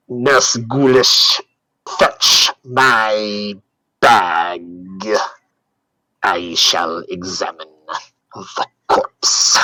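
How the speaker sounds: slow, low, drawn-out doctor voice; sinking, deliberate character voice with breathy ending; commanding doctor. Acted. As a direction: give orders in a deep, ponderous pace.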